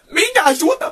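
shocked high-pitched echo; incredulous. Real, not acted. Incredulous narrator echoing the driver's request for help. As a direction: repeat it in pure disbelief.

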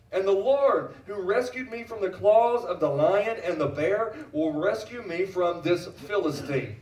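slow, emphatic preaching; David's defiant vow. Acted. Preacher as David vowing to face the Philistine, defiant proclamation. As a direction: proclaim it boldly, stretching the key words.

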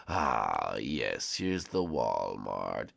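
low, slow villain voice; dark lord. Acted. Villain arrives.

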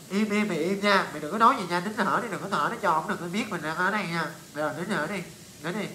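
low hushed pleading to keep still; urgent soft hush while hiding; hushed warning. Retold or imitated. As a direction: hush your friend so the guard won't hear. Friends hiding, hushing each other.

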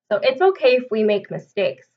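usual speaking voice

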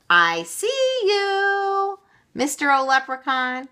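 loud, high gotcha call; shouting. A narrator's reading. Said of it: "shouted Meg".

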